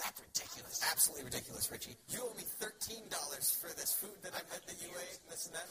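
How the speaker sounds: loud, mock-outraged fiancée; acted indignation. Fiancée haggling as he moves out, acted indignation.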